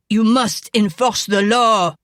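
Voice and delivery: loud, furious demand; crying out. A narrator's reading. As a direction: shout it at the sheriff. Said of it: "Jacques cried".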